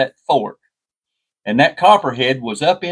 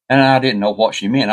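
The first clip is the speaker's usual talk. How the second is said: ordinary delivery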